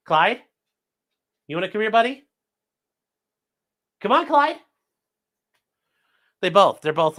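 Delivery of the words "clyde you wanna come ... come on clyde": high coaxing pet voice; lilting call to a dog. Real, not acted. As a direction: coo the dog over. Calling dog Clyde.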